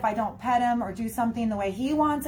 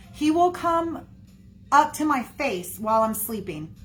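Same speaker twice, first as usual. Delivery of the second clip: ordinary delivery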